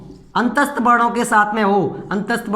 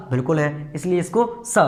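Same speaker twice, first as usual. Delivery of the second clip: ordinary delivery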